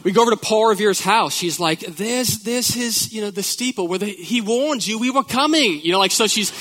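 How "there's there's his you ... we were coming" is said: a light mock British accent, pitched up; a hint of a British tour-guide voice. English mom at Paul Revere's house, in a light mock British accent. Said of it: "with this British accent".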